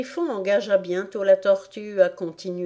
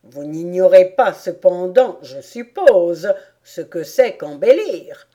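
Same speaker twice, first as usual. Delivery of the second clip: loud, emphatic griffon; griffon incrédule; 8 dB louder than usual. A narrator's reading. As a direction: press her in disbelief. (griffon qui insiste, voix forte)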